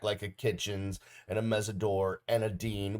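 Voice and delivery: ordinary delivery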